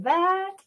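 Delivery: THAT pitched high, sweeping upward; second-tone rise. Shown as a demonstration. Teacher isolating the second tone, THAT rising high. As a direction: lift THAT right up like a question. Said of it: "That's the second tone".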